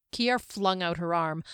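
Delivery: plain reading voice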